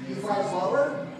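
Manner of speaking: normal talking voice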